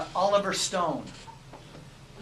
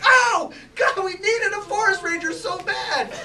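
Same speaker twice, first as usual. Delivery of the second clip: high, loud relieved shout breaking into laughter; relieved cry; 11 semitones higher, 6 dB louder than usual. Retold or imitated. Scene partner greets the rescuer with a loud relieved cry. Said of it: "And you're like".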